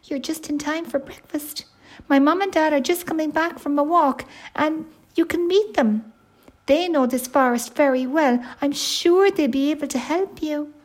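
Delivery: high, chatty bear cub; little bear. Acted. Baby bear tells his guest about his parents, high voice. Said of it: "said the little bear".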